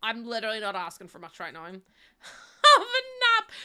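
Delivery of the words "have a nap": laughing, high and loud. Real, not acted. Laughing suggestion to granny.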